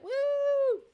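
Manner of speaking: high whooping cheer; a real burst of victory; excited woo. Real, not acted. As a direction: whoop it out like you just won. Cheering at the end of the stream.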